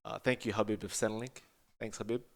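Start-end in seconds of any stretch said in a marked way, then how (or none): none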